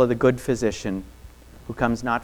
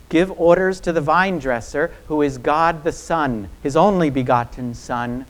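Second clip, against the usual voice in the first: usual speaking voice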